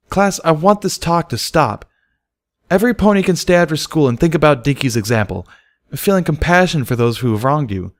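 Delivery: a teacher scolding with discontent; stern teacher. A narrator's reading. Teacher scolding the class for teasing Dinky, stern. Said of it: "her eyes narrowed with discontent".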